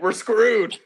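high despairing "we're screwed"; resigned groan. Pretend. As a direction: throw up your hands in defeat. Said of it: "I'd be like".

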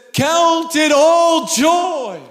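high, slow, ringing shout on Count it all joy; proclaimed shout. Acted. Preacher to congregation, proclaiming James in a slow shout. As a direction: stretch out the word all and shout it.

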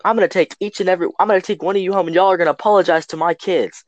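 high, insistent mock scolding; hammed-up threat at normal volume; irate mother imitation. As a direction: imitate a mom laying down the law. Retold mother threatens the boys.

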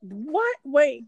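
high, loud disbelief; surprised. Real, not acted. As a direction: stop them in shock. Reacting to shocking story.

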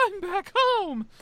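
high, loud, drawn-out cry of joy; joyful homecoming. Retold or imitated. Acting out his return to Wawa, joyfully. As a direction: belt it out slowly, overjoyed.

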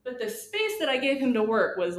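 usual speaking voice

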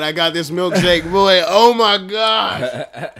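loud stretched oh my gosh; loud exclaiming. Real, not acted. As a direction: exclaim over the burn. Burning from hot wing.

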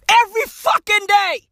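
normal talking voice

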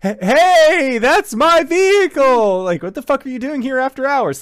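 high, loud indignant yell; angry shout. Retold or imitated. Car owner yelling at trespassers, high and loud indignant. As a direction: bark at the intruders by his vehicle. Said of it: "show up out of the bushes like".

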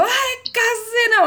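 high mock TV-host cry; Gilberto Barros yell. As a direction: belt it out like a hammed-up TV host. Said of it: "o Gilberto Barros gritou".

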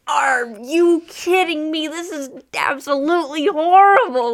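put-on slow, high, loud outraged old lady; grandma outraged at the teens' radio, slow and loud; disgusted grandma. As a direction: drag it out like a scandalized grandma.

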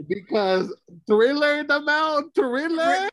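high, loud, drawn-out disbelief; incredulous outrage. Real, not acted. Defending being scared by Thriller, incredulous outrage. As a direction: exclaim it way up high, aghast.

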